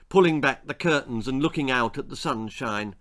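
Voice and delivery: usual speaking voice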